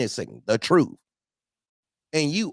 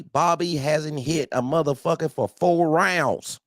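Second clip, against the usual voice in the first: ordinary talk, nothing marked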